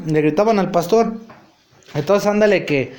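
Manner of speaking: normal talking voice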